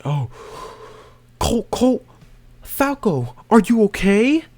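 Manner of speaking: squeaky high anxious friends calling out; high mock worried voice. Friends calling out to Falco, squeaky high mock worry. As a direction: pitch it way up like fretting teammates. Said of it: "they say".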